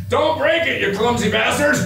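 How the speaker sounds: high, loud mock heckler's yell; retold yell. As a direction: bark it up at the stage like a rowdy soldier. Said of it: "one of them yelled up to the dais".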